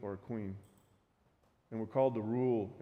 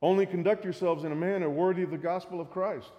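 raised, declaiming read; scripture reading; 4 semitones higher, 3 dB louder than usual. Acted. Pastor reading Philippians aloud, raised and declaiming.

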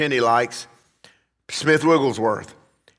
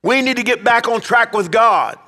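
high, emphatic pulpit call; preaching exhortation; 8 semitones higher, 4 dB louder than usual. Real, not acted. Preacher urges the church back to God, forceful exhortation. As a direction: drive the appeal home from the pulpit.

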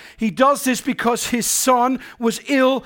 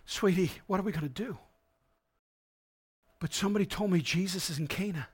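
soft, fast anxious words to his wife; soft, hurried worried father; hushed worried husband; 3 semitones lower, 12 dB quieter, faster than usual. Acted. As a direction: rush it quietly, worried. Father voiced talking to wife.

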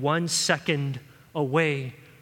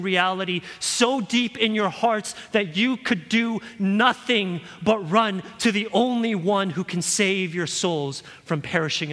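louder, higher, urgent preaching climb; preaching at full force; 5 semitones higher, 3 dB louder than usual. Real, not acted. Preacher warning the congregation about hell, preaching at full force.

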